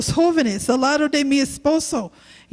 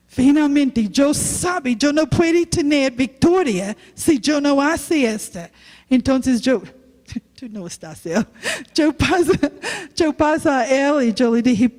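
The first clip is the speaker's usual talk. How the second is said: normal talking voice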